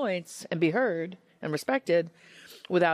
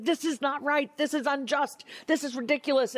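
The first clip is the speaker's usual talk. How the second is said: higher, indignant protest; raised-pitch mock indignation; retold anger; 9 semitones higher than usual. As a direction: replay her own outraged complaint. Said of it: "I'm mad about something".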